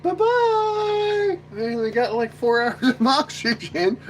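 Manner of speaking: very high laughing farewell; mock astronaut farewell. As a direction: drift off cheerfully waving bye. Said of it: "And you're like".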